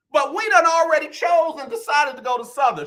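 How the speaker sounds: high-pitched, insistent proclamation; hammed-up emotional declaration. Father insisting to his daughter on their choice, hammed-up emotional declaration. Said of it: "I said it just like that".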